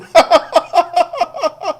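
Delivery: very high cackling laugh; hearty laugh. Real, not acted. Host ridicules a weak referee scheme, laughing heartily. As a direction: burst out laughing at your own insult. Laughs at toilet paper insult.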